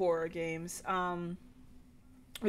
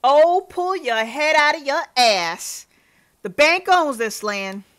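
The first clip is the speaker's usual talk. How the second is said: put-on Southern accent; 11 dB louder than usual. Landowner rowing with a lady over land, angry Southern accent. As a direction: bellow it crossly with a Southern twang. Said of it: "when I put in that accent".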